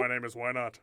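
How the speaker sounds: smooth YouTuber introduction; Markiplier. Acted. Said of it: "I'd love to sound like Markiplier".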